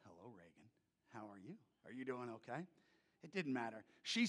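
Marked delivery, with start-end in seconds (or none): 0.0-2.7 much lower and softer, hushed; tender hushed greeting to a scared girl; soft gentle hello. Retold or imitated. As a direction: coax a shy child quietly. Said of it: "I would go".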